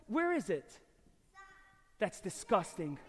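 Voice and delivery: ordinary talk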